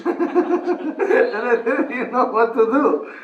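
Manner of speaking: loud, high, drawn out with laughter; laughing retelling. Retold or imitated. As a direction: barely get it out for laughing. Laughs at the helpless novices.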